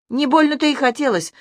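high, hot-tempered retort; heated outburst. A narrator's reading. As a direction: fling it back hotly. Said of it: "запальчиво выкрикнула девушка".

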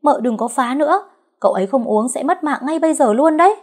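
loud, high scream. A narrator's reading. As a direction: scream at her to stop. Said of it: "Mai gào lên". (Mai gào lên giận dữ)

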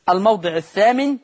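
ordinary talk, nothing marked

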